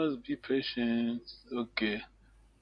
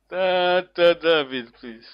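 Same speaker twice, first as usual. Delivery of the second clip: ordinary delivery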